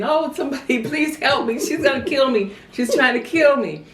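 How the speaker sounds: high-pitched retold plea for help; hammed-up cry for rescue through laughter; laughing mock yell. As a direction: mimic a panicked kid yelling, amused. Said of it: "I was yelling, you know, to the top of my voice".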